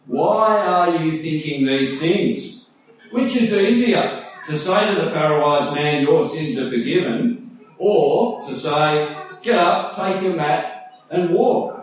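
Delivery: slow, measured Jesus speaking with authority. Acted. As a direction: question the doubters slowly, as Jesus. Jesus voiced in dramatized reading.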